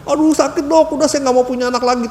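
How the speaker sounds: high, pained plea to the doctor; imitating a birthing mother begging the doctor; mock cry of labour pain. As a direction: whine it like a mother mid-labour. Woman in labour crying out.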